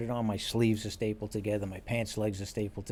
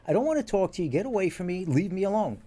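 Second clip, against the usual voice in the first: higher and louder, get away from me; mock angry boss; 8 semitones higher, 5 dB louder than usual. Boss telling the detective off, mock angry. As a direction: retell the boss pushing him off.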